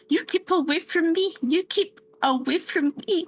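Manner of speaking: high scared plea to keep away; frightened warning. Acted. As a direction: push him back out of fear. Gerta warns the man off.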